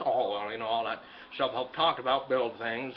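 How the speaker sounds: ordinary delivery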